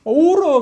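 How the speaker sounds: high, loud mock wail of a crying child; imitated bawling. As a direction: mimic the kid howling at full volume. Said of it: "괌을 지르고 웁니다". (아이 울음소리 흉내, 높고 크게)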